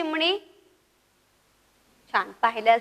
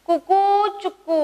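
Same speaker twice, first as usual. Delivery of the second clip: higher, drawn-out "kukoo-chukoo"; rooster crow; 5 semitones higher, slower than usual. Shown as a demonstration. Teacher voicing rooster for pupils to repeat, long crow. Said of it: "माझ्या मागं म्हणायचंय".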